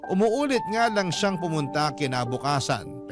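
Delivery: ordinary delivery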